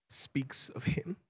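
slow, hushed whisper at the peak of emotion; overwhelmed whisper. Retold or imitated. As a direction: let the words fall away into a whisper. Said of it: "become overwhelmed".

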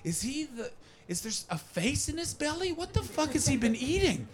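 high, soft voice of disbelief; baffled onlookers. Retold or imitated. Comedian voices a crowd eyeing a posing man's belly, high-pitched bafflement. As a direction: puzzle it out in a high, incredulous voice. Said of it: "and we're all going".

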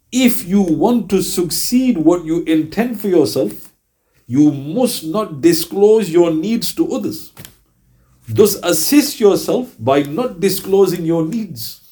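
slow, deliberate hadith quote; solemn recitation. Acted. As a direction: lay each word down slowly, with reverence.

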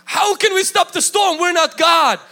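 high-pitched, disbelieving outcry; acted panicky objection, pitch leaping up; incredulous protest. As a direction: protest like an overwhelmed disciple. Acts out disciples protesting to Jesus.

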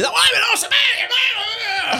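mock outburst. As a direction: blurt it out in protest. Mimicking someone getting annoyed.

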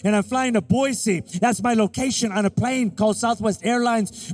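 impassioned preacher driving the point home; full-force preaching. Real, not acted. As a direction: build in rolling phrases and land each one hard.

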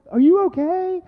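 high, loud concerned holler; calling out. Retold or imitated. Couple checks on screaming lost hikers, high loud call. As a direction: shout the question across a distance. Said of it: "you couldn't hear us over your screaming".

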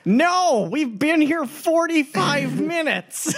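laughing exasperated outburst. Real, not acted. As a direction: shout it out incredulously. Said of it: "player louder exasperated repeat".

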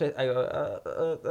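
normal talking voice

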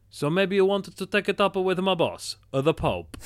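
high, loud mock Italian accent; Russell Crowe's Italian accent. Exorcist threatening critics with his boss, hammed-up Italian accent. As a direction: ham up the Italian accent for a big punchline. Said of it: "I am accurately quoting verbatim here".

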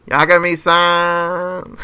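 slow drawn-out cutesy name call; failed anime voice. Retold or imitated. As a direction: try a cute anime voice, slowly. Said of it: "Can't do the voice".

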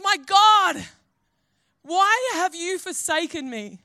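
high, loud cry, easing off slightly; anguished cry. Acted. Jesus on the cross crying to God, yelling. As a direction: call out in despair to God. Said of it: "And he yells out".

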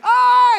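high, loud imitated scream of pain; mock child's scream. Dad imitating his daughter stepping on a toy, mock scream. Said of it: "she's screaming and she's crying".

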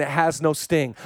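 normal talking voice

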